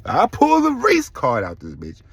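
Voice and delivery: high, loud and slow, daring them; loud slow defiance; defiant outburst. Real, not acted. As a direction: slam the threat down. Defiant threat to Florida.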